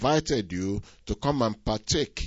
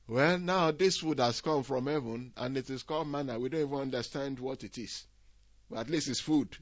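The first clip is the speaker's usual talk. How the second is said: lightly acted grudging murmur, softer; doubting Israelites; 2 semitones higher, 8 dB quieter than usual. Israelites in the wilderness puzzling over manna, lightly acted doubt. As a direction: voice a doubter under the breath, understated. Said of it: "those who did not have enough faith".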